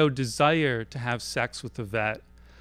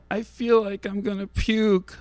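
high, moaning fake-sick voice; sickly moan; 7 semitones higher than usual. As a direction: groan it out as if about to vomit. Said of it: "I moan in my sickliest voice".

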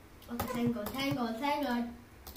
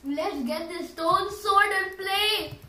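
loud; 4 semitones higher, 7 dB louder, slower than usual. Real, not acted. Loud slow game call.

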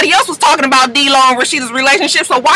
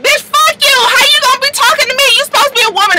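mock snapping back; 13 semitones higher, faster than usual. Treasure clapping back at Jessica, high mock anger. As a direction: fire back at her. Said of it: "Treasure was just like".

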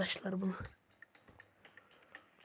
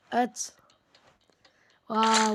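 ordinary talk

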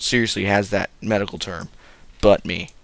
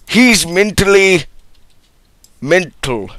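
1 mock doctor; 8 semitones higher, 10 dB louder than usual. Doctor's verdict.

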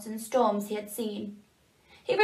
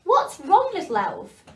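higher, louder caring bird voice; a lightly voiced kind woodpecker; 8 semitones higher, 6 dB louder than usual. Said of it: "asked the woodpecker".

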